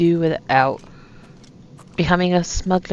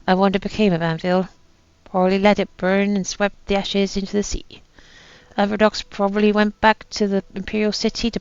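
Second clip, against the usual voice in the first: put-on reading aloud in an amateur pirate accent. Gamer reads a doomed captain's last note, pirate accent. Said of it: "that was my best pirate accent".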